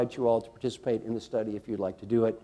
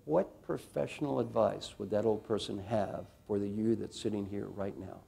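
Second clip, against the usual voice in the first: hushed guided visualization; guided reflection; 2 semitones lower, 4 dB quieter than usual. Acted. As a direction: lead them inward gently.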